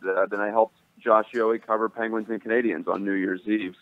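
ordinary delivery

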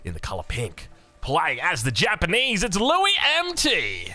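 big ring-announcer build, stretching the name out high; ring announcer intro. Acted. Caster hyping the first player to viewers, ring-announcer style. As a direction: call the player in like a title fight.

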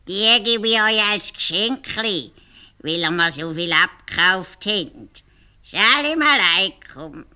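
langsam, lauter und feierlich; feierlicher Hausierer. Acted. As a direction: verabschiede dich würdevoll und gedehnt. Hausierer verabschiedet sich. (Hausierer verabschiedet sich langsam und feierlich)

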